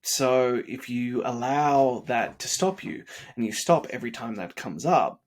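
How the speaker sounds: normal talking voice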